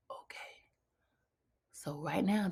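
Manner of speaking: soft, hushed realization; whisper. Retold or imitated. Telling herself her new plan, whispered. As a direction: whisper it to yourself as it dawns. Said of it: "And I was like".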